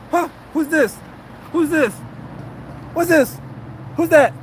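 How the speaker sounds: hammed-up disbelief at his own photo; mock surprise. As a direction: gasp over the photo like a wide-eyed fan. Mock amazement while showing a photo.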